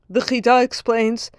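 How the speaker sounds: ordinary talk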